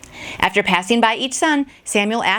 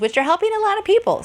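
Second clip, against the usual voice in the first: fast and much higher, tumbling out eagerly; bubbly excitement; 10 semitones higher, faster than usual. Acted. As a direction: rush it out with cheerful pride. Enthusing about her videos.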